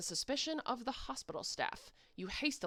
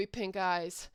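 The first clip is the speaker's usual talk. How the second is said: ordinary talk, nothing marked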